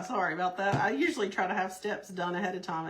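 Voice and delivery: usual speaking voice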